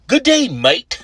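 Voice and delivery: high-pitched, hammed-up Aussie greeting; amateur Australian impression, high and playful; Australian accent. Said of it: "go into my Australian accent".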